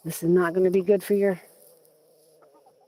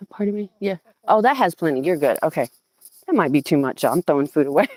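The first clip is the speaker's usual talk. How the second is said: ordinary talk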